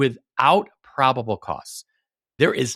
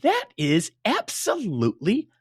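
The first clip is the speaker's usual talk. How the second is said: much higher, louder, "outrageous" stretched; outraged; 6 dB louder, slower than usual. Real, not acted. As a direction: erupt in disbelief at the injustice. Outrage at police pressure.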